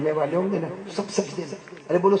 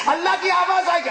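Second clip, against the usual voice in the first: high, fast, ringing declaration; booming voice of God; 5 dB louder, faster than usual. Shown as a demonstration. Preacher booming God's call to the crowd. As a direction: proclaim it like a voice from the heavens. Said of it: "اللہ کی آواز کیسی ہوگی میرے دوستو؟".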